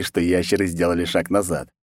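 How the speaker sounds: ordinary delivery, nothing marked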